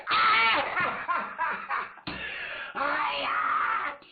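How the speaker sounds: high, loud howl of pain; pain scream. Acted. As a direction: scream as the ear is torn off. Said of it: "疼的姚天军". (疼得高声惨叫)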